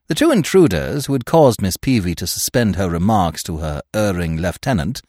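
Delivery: usual speaking voice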